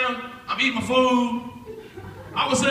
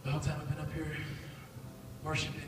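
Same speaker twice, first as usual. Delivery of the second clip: gentle prophetic voice; 8 semitones lower, 16 dB quieter than usual. Retold or imitated. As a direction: deliver it tenderly, like a revelation. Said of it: "He's like".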